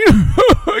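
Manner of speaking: a sudden loud laugh, pitch jumping up; loud burst of laughter. Real, not acted. Laughs at own punchline.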